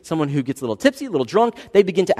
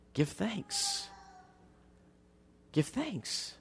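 much softer echo; soft repeat; 4 semitones higher, 16 dB quieter, much slower than usual. Shown as a demonstration. Pastor softly echoing the call to thanks. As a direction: breathe the phrase gently. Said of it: "soft repetition".

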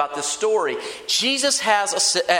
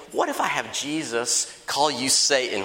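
lower, offhand suggestion; casual pitch; 5 semitones lower than usual. Acted. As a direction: float the idea casually to a friend. Mark casually pitches idea to Peter.

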